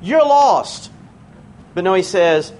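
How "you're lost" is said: high-pitched blunt pronouncement; mock judgement, pitched up and a bit punched; harsh verdict. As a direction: act out a graceless condemnation. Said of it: "He doesn't say".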